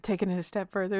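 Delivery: ordinary delivery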